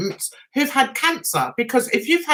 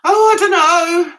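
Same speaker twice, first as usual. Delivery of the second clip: louder, higher nervous fretting; mock nervous fluster over the pills; fretful; 7 semitones higher, 5 dB louder than usual. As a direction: replay the panic, louder and higher. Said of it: "And I said".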